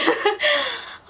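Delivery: squealing laugh; high laughter burst. Real, not acted. Laughing at her own diary.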